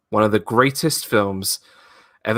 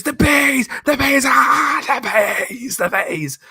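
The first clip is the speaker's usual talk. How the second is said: panicked scream; 12 semitones higher, slower than usual. Retold or imitated. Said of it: "And then he goes".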